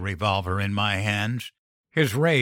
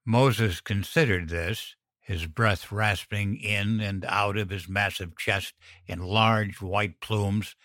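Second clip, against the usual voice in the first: usual speaking voice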